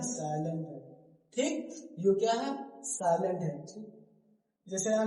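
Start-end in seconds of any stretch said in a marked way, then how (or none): none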